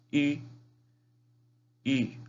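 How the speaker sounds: "I" as French u, high pitch; u with diaeresis. Shown as a demonstration. Teacher showing pinyin vowel, "I" as rounded French u. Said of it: "se dice como una U con diéresis".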